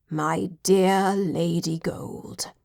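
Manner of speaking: lightly voiced old butler, slow and soft with a slight quiver; a touch of an aged butler's emotion. A narrator's reading. Butler greeting Lady Gold after years apart, slow and faintly quivering. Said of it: "He spoke her name with a slight quiver".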